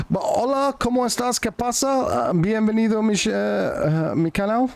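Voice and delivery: mock Spanish. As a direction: play at speaking Spanish badly. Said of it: "I'm going all French".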